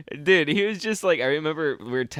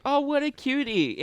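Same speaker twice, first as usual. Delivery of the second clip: much higher mock coo; cooing flight agent; 13 semitones higher than usual. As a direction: imitate the agent gushing at the cat. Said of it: "the female checking you into your Delta flight being like".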